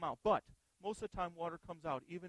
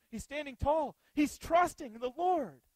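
rising triumph; 5 semitones higher, 7 dB louder, slower than usual. Real, not acted. Triumphant preaching build.

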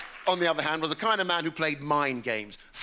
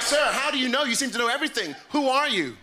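higher, wide-eyed tourist questioning; American tourist; 7 semitones higher than usual. Retold or imitated. Tourists quizzing the local who knows everything, mock American. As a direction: quiz him like an amazed American tourist. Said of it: "I knew they were American".

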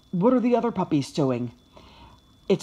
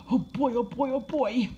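higher-pitched puppy eagerness; bubbling anticipation; excited; 5 semitones higher than usual. A narrator's reading. Puppy watching Josh reach into his bag, excited. Puppy thrilled as treat comes.

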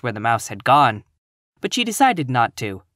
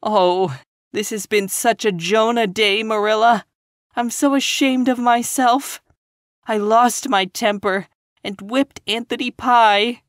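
put-on higher, ashamed girl near tears; tearful; 9 semitones higher than usual. A narrator's reading. Ashamed young teacher to Marilla, tearful. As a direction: lament it through a catching voice. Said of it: "When Anne's sobs grew quieter".

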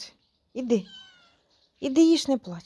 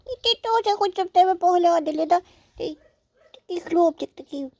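higher cooing voice; pet talk; 4 semitones higher than usual. Real, not acted. Coaxing the jealous cat in a higher cooing voice.

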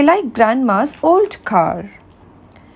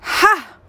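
villain's scornful ha; 9 semitones higher than usual. A narrator's reading. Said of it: "Bad Jack looks at the children".

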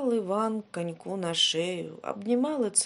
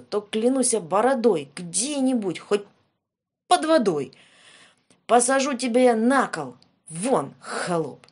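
angry threat; 2 semitones higher, 7 dB louder than usual. Acted. Tsar threatens impalement.

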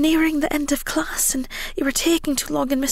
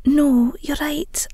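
ordinary delivery

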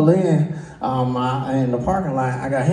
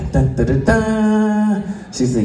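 usual speaking voice

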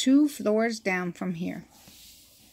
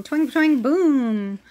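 guitar twang; 4 semitones higher, 4 dB louder than usual. A narrator's reading. Rock store noises.

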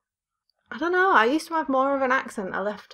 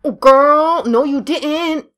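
loud, slow sassy comeback; sassy diva; 5 semitones higher, 8 dB louder, much slower than usual. Pretend. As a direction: give it full diva sass.

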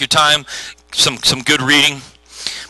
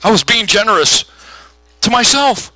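sheepish excuse; 7 semitones higher than usual. Acted. Acted excuse for debt.

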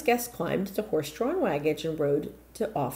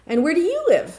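eager; 11 semitones higher, 7 dB louder than usual. Acted. Rabbi's son asks eagerly.

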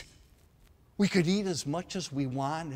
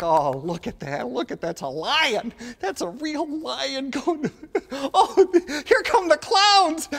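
rising higher and louder as the parade arrives; thrilled kid at a parade; childlike excitement; 9 semitones higher, 5 dB louder than usual. Retold or imitated. Kid watching the circus parade, building excitement. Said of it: "it'd be like".

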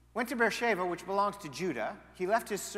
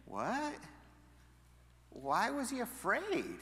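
mock disbelief; 5 semitones higher, slower than usual. Preacher feigns disbelief.

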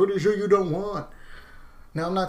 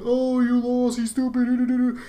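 higher, louder mock taunt; 9 semitones higher, 5 dB louder than usual. Mocking people who taunted the losers, higher and louder. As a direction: imitate a smug taunting commenter.

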